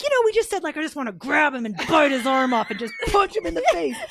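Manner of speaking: mock-ferocious gushing over a cute baby; mock fierce. Teen telling mom how they gush over a baby, in mock fury. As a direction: growl out the cute aggression, half-laughing. Kids' cute-aggression quote.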